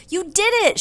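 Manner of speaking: loud, high burst of praise; exclaiming. A narrator's reading. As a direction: cheer a child's success. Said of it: "she exclaims".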